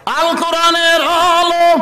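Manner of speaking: high, loud, drawn-out chant; slogan shout. Real, not acted. Preacher starting a slogan for the crowd, high loud chant. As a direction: sing out a rallying call.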